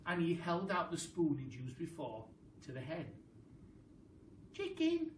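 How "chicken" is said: high coaxing call; calling the chicken. Acted. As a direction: sing out to lure a hen. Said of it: "he said".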